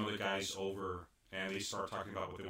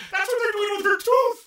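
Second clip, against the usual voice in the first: laughing; 16 dB louder than usual. Real, not acted. Recounting scene through laughter.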